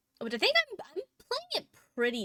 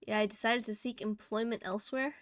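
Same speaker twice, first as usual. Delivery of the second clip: ordinary talk, nothing marked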